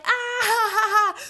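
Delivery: loud, high imitation guffaw; retold burst of laughter. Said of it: "just, like, burst out laughing".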